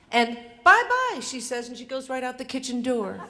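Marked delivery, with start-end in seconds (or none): 0.1-1.2 higher, louder, chirpy brush-off; breezy teen goodbye. Retold or imitated. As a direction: toss it off while walking out. Daughter escaping the talk.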